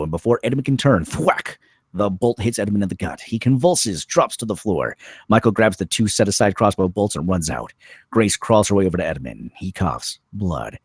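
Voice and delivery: ordinary talk, nothing marked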